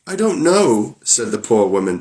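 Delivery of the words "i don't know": high, frightened little denial; terrified wife denying to Bluebeard. Acted. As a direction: blurt it out, scared stiff. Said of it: "paler than death".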